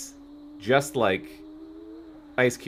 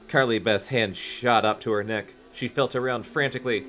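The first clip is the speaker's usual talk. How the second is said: ordinary delivery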